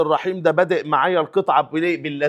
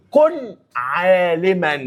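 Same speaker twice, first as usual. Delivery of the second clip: slow reading; much slower than usual. Shown as a demonstration. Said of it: "repeats the passage slowly".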